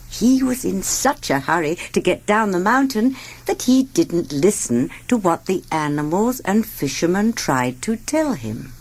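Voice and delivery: plain reading voice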